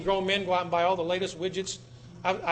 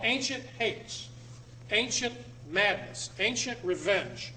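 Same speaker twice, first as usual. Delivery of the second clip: slow emphasis; slower than usual. Acted. Lecturer dwells on ominous phrase.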